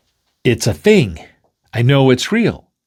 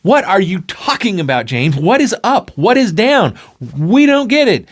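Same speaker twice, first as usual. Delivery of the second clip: exasperated friends; 8 semitones higher than usual. Acted.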